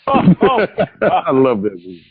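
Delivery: slow, laughing mock praise; drawn-out ironic praise through laughter; laughing sarcasm. Real, not acted. Friends mocking a bad movie, slow laughing ironic praise.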